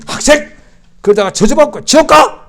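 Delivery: high, loud scolding cry; retold angry shout. Church mother shouting at the insulting schoolboy, loud and high. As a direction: snap back at the rude student. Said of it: "얼굴이 파게 지더니".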